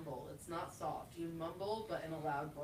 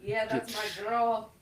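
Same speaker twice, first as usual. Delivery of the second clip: louder, brash imitation; mock loud friend; 20 dB louder than usual. Quoting a loud friend, brash. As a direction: play it loud and brassy. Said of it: "A Renee said".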